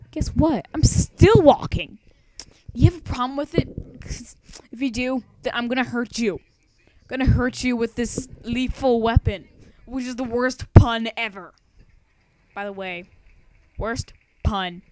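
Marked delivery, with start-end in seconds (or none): 0.1-11.5 mock threat. Threatens the viewer jokingly.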